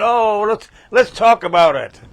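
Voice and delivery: mock-friendly reeling them in; a hint of putting on a coaxing voice; coaxing lure. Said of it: "pulling them in".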